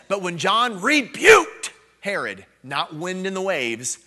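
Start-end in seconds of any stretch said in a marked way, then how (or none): 0.1-2.5 emphatic scripture read. Real, not acted.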